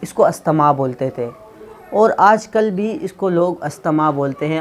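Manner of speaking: ordinary delivery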